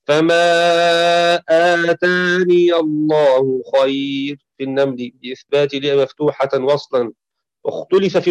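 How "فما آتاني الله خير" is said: slow, drawn-out tajweed recitation; Quran recitation. Shown as a demonstration. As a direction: recite it slowly, as in prayer. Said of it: "وقرأ نافع وأبو عمرو وحفص". (تلاوة مرتلة بطيئة)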